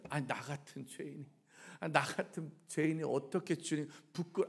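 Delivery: soft, low, hesitant confession; ashamed penitent. Acted. Sinner hesitating before the Lord, ashamed and quiet. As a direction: shrink back quietly, too ashamed to finish.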